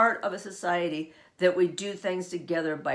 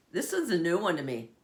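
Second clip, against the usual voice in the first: ordinary delivery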